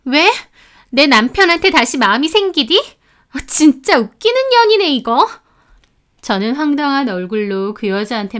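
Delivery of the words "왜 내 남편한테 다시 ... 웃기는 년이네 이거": sneering mockery. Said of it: "하는 말이 가관이었습니다".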